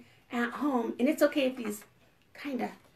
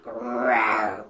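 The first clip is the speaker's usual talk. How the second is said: deep mock tiger growl; playful low animal growl. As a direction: growl low like a big cat. Tiger growl behind door.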